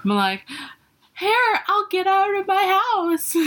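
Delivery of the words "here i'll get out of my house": much higher, hammed-up frantic voice; mock panicked self. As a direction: squeak it out like you're losing it, half laughing. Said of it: "I'd probably be more erratic".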